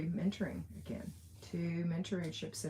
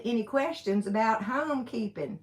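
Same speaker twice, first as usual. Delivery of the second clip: louder, higher, thick Alabama accent on "homekeeping"; broad Alabama accent; 5 semitones higher, 8 dB louder than usual. Shown as a demonstration. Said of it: "That's my Alabama accent".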